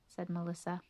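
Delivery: plain reading voice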